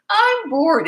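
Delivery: high, loud spoiled whine; snotty whine. Retold or imitated. Daughter home from a friend's, whining snottily to mom. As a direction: whine it like a spoiled five-year-old. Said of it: "in that snotty, disrespectful tone".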